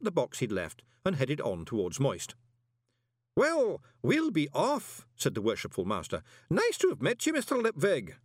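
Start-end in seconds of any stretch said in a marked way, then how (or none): 3.4-5.1 put-on high, slow elderly man voice; a fond old man taking leave; old worshipful master. A narrator's reading. Old master saying goodbye to Moist, slow and high. 6.5-8.2 put-on a fond old man taking leave; old worshipful master. A narrator's reading.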